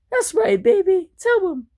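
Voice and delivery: very high, egging-on wife voice. Acted. Wife backing husband against motorhome, very high. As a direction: cheer your man on in falsetto.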